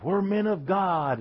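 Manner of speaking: high, drawn-out mock rally call; imitating a hyped-up rally speaker; stadium preacher rallying men. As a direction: rouse the crowd with a stretched, high-pitched cry. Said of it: "they're all jacked up".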